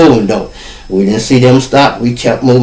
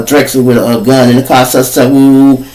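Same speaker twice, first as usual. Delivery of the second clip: usual speaking voice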